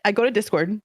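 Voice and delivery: normal talking voice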